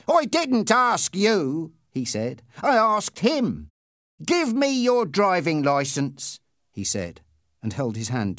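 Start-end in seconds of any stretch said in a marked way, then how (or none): 0.1-1.7 slow, high, loud put-down; stern rebuke. A narrator's reading. Policeman silencing the driver who answered, stern rebuke. 2.6-6.4 slow, high, forceful command; stern order. A narrator's reading. Policeman demanding the driver's licence, stern order.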